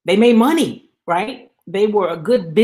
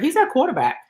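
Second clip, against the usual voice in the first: mocking quote; 7 semitones higher, faster than usual. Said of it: "We heard".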